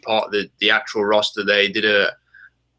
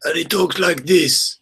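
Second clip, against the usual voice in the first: put-on higher, slow Slovakian villain accent; Bond villain accent; 7 semitones higher, slower than usual. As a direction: imitate a thick Bond-villain accent, drawn out. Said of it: "fantastic Bond villain style accent".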